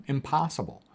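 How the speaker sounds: usual speaking voice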